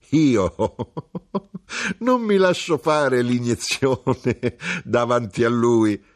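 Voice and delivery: high voice breaking into laughter between words; laughing. A narrator's reading. Widow to the mother over the injection, laughing. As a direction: giggle through the refusal, a little embarrassed. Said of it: "E la vedova rise".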